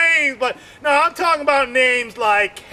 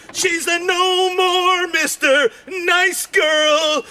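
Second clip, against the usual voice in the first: worked-up rant; 7 semitones higher than usual. Acted. Comedian ranting at his buddy about a hit woman. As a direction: shout it like a wild revelation. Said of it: "I'm like".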